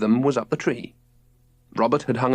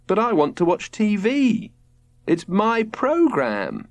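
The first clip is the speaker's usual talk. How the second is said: higher, drawn-out whine; wailing child; 10 semitones higher, slower than usual. A narrator's reading. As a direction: wail like a kid denied TV. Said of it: "wailed Robert".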